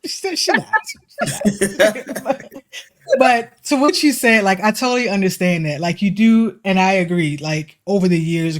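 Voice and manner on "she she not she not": giggling through the words, pitched up; laughing. Real, not acted. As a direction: laugh as you take it back. Laughing after defending sister.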